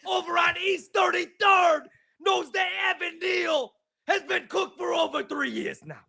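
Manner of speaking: high shouted New York rant; mock New York caller, outraged; New York accent. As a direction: bellow like a pizzeria regular calling in. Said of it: "That's a good accent".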